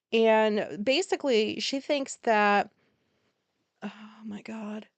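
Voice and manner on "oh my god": breathy disbelieving whisper; whispered oh my God. Real, not acted. Reacting to the niece's flat-earth claim, whispered disbelief. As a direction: drop to a stunned whisper. Shocked aside.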